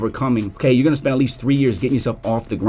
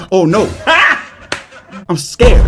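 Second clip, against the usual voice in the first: sarcastic high laughing "I'm scared"; mock fear; 7 semitones higher, 7 dB louder, slower than usual. Vlogger mocking promo companies with fake fear. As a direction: laugh off the threat, loud and high.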